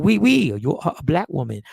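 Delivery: loud French "oui", then low mock Chinese; botched French-Chinese accent. Mocking own failed shopkeeper impression, botched French-Chinese accent. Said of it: "how the Chinese girl say".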